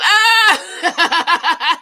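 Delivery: loud high burst of laughter; laughing shout. Real, not acted. Reacting to a viewer's cooking comment, loud laughing shriek.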